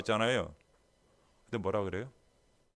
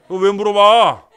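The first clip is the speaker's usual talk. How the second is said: loud, high, slow yell with a laugh; exasperated yell; 7 semitones higher, 18 dB louder, slower than usual. Pretend. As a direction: blow up at the question, laughing. Boyfriend bursting out. (짜증나서 웃으며 소리치는 남자친구)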